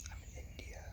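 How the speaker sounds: breathy conspiratorial whisper, almost no voice; secret whisper. Pretend. Whispered reveal of location.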